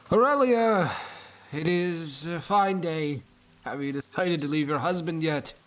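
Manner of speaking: put-on loud, high, slow drunken call. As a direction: slur it out as a drunk. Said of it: "gravelly, drunken voice".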